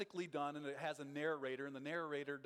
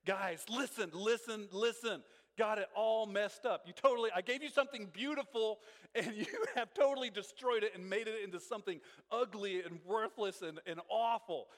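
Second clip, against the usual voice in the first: higher, louder urgent call to listen; Paul grabbing the Galatians' attention, louder and urgent; attention-grabbing plea; 4 semitones higher, 4 dB louder than usual. Retold or imitated. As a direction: grab the room and hold it, pleading. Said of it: "get the people's attention kind of scenarios".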